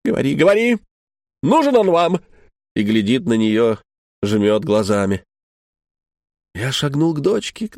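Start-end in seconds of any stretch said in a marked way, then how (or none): 0.1-2.2 mother shouting at daughter to speak. Retold or imitated. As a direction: push the girl to answer, shouting. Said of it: "и кричит".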